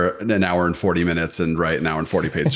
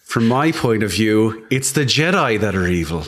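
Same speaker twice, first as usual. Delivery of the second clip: put-on Irish accent. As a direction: lay on a thick Irish accent. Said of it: "With a great Irish accent".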